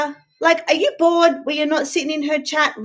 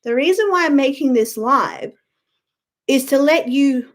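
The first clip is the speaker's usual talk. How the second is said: normal talking voice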